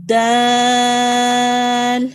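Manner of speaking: دال very slow and loud, the long aa stretched; drawn-out letter. Shown as a demonstration. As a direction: stretch دال slowly at full voice. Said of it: "تو لمبا کریں گے".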